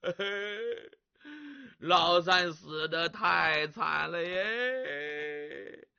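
slow, hushed mock lament; low voice. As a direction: drag it out in a low mournful murmur. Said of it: "还得压低声音说话".

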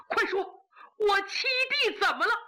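high, urgent, near tears; frantic brother pleading for news; anxious demand. Acted. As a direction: beg to know, pitch climbing.

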